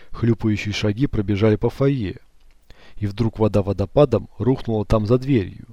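usual speaking voice

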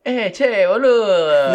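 loud, high mock Argentine call; Argentine accent. Host teasing the guest about Argentina, hammed-up Argentine accent. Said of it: "imitaste el acento argentino".

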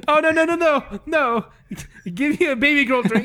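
high-pitched comic whimpering protest; mock near-crying. Acting out a man refusing a strong drink, mock near-crying. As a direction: plead like a drinker who can't take it, half laughing. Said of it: "he's about to cry from drinking".

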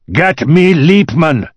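slow, high, loud bellow; furious scream. A narrator's reading. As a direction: bellow an order at full voice. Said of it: "screamed at the top of his voice".

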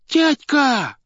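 put-on very high, loud child's cry; child's shout. A narrator's reading. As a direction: shriek it out like a kid in the gallery. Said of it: "крикнул детский голос". (детский крик)

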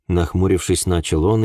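ordinary delivery, nothing marked

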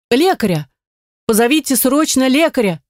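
high, panicked call for help; frightened shout. Acted. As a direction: cry out for a healer in fear. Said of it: "Испуганно кричу я".

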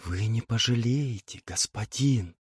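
soft seductive whisper. A narrator's reading. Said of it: "зашептала русая".

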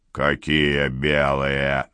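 low, slow menacing question; incredulous growled roar. A narrator's reading. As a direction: drag it out low, full of threat. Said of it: "взревел Чиж".

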